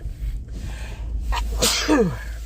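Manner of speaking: real coughing fit, high and strained; harsh coughing fit. Real, not acted. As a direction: cough hard, bent over. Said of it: "I'm gonna sneeze".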